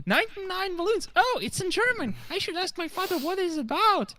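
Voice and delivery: very high, squeaky cartoon pony voice; Pinkie Pie. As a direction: squeal it like an eager little pony. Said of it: "Pinkie Pie heard a song about balloons".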